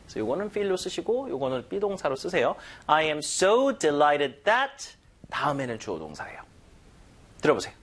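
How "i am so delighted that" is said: "so" held long and strong, "that" rising at the end; stress on so. Shown as a demonstration. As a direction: put the weight on "so". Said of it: "so의 강세를 주시는데". ("so"에 강세)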